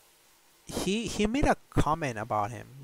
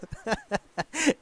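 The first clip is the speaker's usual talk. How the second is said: a high real laugh; laughing hard at himself; 9 semitones higher than usual. Real, not acted. Laughing at the memory.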